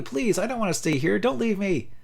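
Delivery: high, desperate begging; a child sobbing not to be left; delirious girl begging her parents, high and tearful; crying plea. Acted. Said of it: "Her cries mingle with tears".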